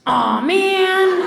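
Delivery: high, loud mock "aw, man"; imitated husband's dismay. Wife imitating husband spilling on his shirt, mock dismay. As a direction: whine it like someone who just spilled.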